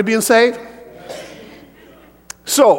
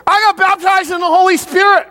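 much higher, louder exultant cry; excited shout; 11 semitones higher, 3 dB louder than usual. Shown as a demonstration. Preacher proclaims his baptism to the church, shouting. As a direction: proclaim it like a triumph. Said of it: "Oh, that'll really cause some problems".

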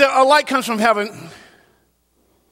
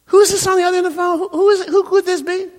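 high, quick, mock-bewildered questioning; pitched-up imitation of a confused person on the line; puzzled phone answerer; 12 semitones higher, faster than usual. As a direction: play someone baffled by a mystery caller.

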